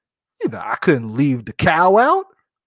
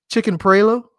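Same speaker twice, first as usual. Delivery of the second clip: put-on South Carolina accent; 6 semitones higher, 3 dB louder than usual. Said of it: "going straight South Carolina on this".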